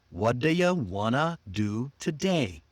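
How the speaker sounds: unhurried, spaced-out reduced phrase; slow and clear. Shown as a demonstration. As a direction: stretch it out word by word for learners. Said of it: "Slow and clear".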